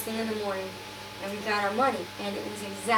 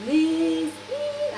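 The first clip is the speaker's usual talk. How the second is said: higher-pitched imitation of a Dutch accent; 6 semitones higher than usual. Dutch friend phoning her in the morning, imitated accent. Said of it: "a Dutch accent".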